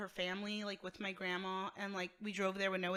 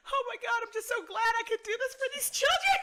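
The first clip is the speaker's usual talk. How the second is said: high, loud mock-weepy gushing; hammed-up happy crying, voice pitched way up; tearful happy; 14 semitones higher, 6 dB louder than usual. Mom watching kids at splash pad, mock tearful joy. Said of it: "I started tearing up".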